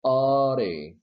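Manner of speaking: "audi" with a quick tongue tap for the d; flap D. Shown as a demonstration. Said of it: "you pronounce it".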